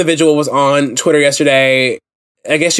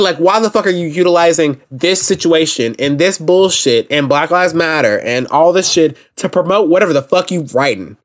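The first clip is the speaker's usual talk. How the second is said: ordinary talk, nothing marked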